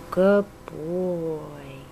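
low, slow tender praise. Real, not acted. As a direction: praise the bird lovingly.

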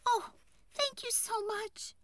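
very high, tiny fairy voice; grateful tree fairy. A narrator's reading. Tree fairy thanks woodcutter for sparing tree, high and grateful.

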